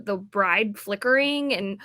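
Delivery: ordinary talk, nothing marked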